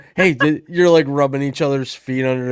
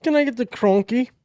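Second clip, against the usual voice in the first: put-on high-pitched silly British accent; mock British accent; 12 semitones higher than usual. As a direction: tease with a squeaky put-on British voice. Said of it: "in a British accent".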